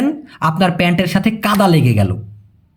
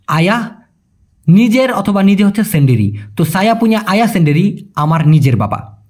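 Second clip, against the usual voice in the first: ordinary talk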